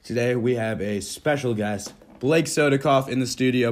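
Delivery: loud, upbeat show host opening; announcer intro. Acted. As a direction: welcome the audience like a radio host. Podcast host opens the show.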